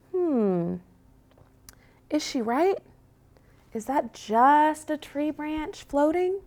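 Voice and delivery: high, small, unsure puppy voice; worried little puppy. Acted. Scared puppy doubting her sister, in a high small voice. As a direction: wonder nervously like a small pup.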